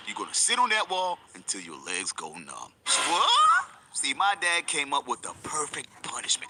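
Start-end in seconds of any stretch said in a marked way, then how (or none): 0.1-2.7 stern dad. Retold or imitated. Dad announces punishment. 2.8-3.7 very high loud startled what; shocked what. Retold or imitated. Kid shocked at dad's punishment, high and loud.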